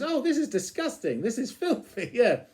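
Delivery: mock outrage at the magazine; hammed-up prim disgust; prissy Rimmer voice. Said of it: "He goes".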